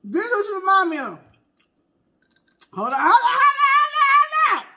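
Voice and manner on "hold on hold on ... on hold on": high, loud laughing hold on; excited remembering. Real, not acted. Recalling the old lady to viewers, high laughing. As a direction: burst out laughing while remembering.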